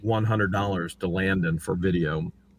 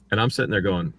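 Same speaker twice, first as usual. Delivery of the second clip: ordinary talk, nothing marked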